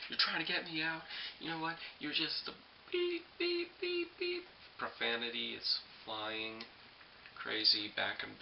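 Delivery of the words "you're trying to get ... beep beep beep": high shrill bleeped cursing; mock angry squeal. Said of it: "She was like".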